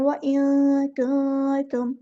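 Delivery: व इन कुंतुम slowly, नून hidden, pausing; don't rush व इन कुंतुम; slow ikhfa recitation. Shown as a demonstration. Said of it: "जल्दी से नहीं पढ़ना". (रुक-रुक कर इख्फा के साथ)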